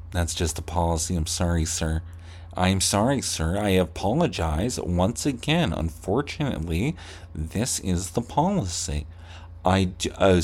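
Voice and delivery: a clerk smoothly deflecting with apologies; polite clerk. Pretend. Clerk to an angry customer, soft and overly polite.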